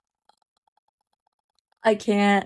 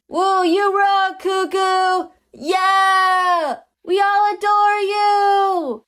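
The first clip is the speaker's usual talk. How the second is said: cheering fans; 8 semitones higher, slower than usual. Acted. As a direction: squeal up to your idol. Fan crowd cheering the idol.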